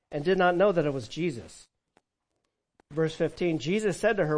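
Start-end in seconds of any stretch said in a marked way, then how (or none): none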